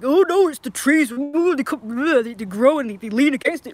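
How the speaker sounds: a hint of the know-all neighbour; imitating the neighbour. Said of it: "he was like".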